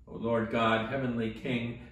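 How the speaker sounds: usual speaking voice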